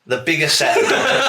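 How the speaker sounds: giggling through the words; laughing. Real, not acted. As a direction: laugh while repeating it. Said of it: "repeat with laugh".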